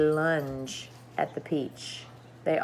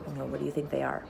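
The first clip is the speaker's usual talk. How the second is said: quiet; 4 semitones lower, 10 dB quieter, much faster than usual. Real, not acted. Asks the child listening.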